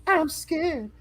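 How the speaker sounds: high, slow mock fright; mock scared. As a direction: play it like a scared kid. Joking during horror movie chat.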